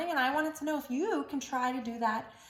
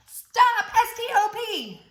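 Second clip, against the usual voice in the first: shouted stop; 9 semitones higher, 7 dB louder, slower than usual. Acted.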